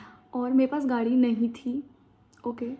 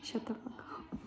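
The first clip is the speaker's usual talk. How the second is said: hushed unvoiced whisper; 14 dB quieter, much slower than usual. Real, not acted. She cuts off her own rant in a whisper. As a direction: breathe it out, no voice. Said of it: "whispered aside".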